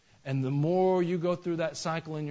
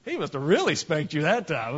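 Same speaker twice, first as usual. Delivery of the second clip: teasing surprise; 3 semitones higher than usual. Retold or imitated. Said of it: "And I was like".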